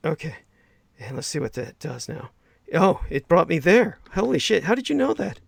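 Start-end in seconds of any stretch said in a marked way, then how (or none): 2.7-5.4 astonished. Real, not acted. Stunned as the computer fix suddenly works. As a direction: pitch climbing higher with each line of amazement.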